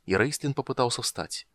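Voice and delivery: ordinary delivery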